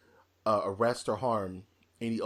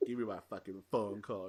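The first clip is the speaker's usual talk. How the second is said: lower, softer mock villain line; quieter repeat; 3 semitones lower, 7 dB quieter than usual. Retelling Boddicker again, softer and lower. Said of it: "He's like".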